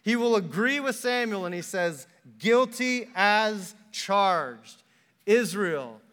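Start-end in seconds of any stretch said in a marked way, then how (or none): 2.4-6.0 loud, very slow pronouncement; booming verdict. Acted. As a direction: thunder it out like a judge, word by word. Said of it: "He booms and thunders his verdict".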